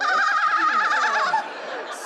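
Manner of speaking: loud, high, squealing laugh; giddy laugh. Acted. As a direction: burst out laughing with giddy delight. Giddy over the new girlfriend.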